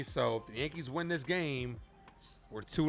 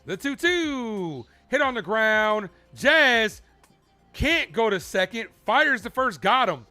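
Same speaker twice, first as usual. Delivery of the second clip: loud, higher-pitched rapid-fire action call; live play-by-play; 6 semitones higher, 9 dB louder, slower than usual. Real, not acted. Streamer calls Jazz fielding a grounder, urgent play-by-play. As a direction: punch out each beat of the play.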